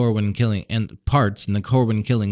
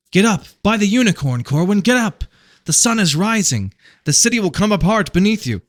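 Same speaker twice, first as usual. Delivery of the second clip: much higher, louder frantic yell; urgent shout; 11 semitones higher, 4 dB louder than usual. A narrator's reading. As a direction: yell at him to get up. Random shouts warning.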